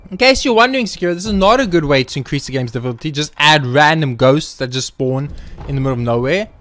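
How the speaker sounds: ordinary talk, nothing marked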